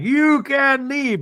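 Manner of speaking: high, drawn-out mock outburst; losing her mind. Meredith at last season's dinner, losing it in a high drawl. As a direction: imitate a slow, high-pitched meltdown. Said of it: "Meredith is losing her mind".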